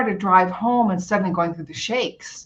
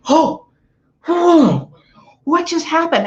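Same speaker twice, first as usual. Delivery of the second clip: gasped, high-pitched astonishment; retold startled gasp; 9 semitones higher, slower than usual. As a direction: act out a sudden jolt of wonder. Acts out sudden reaction to needle.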